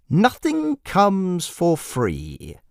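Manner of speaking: high, slow, sing-song jester voice with a cold edge; a creepy clown savouring each word; menacing jester. Acted.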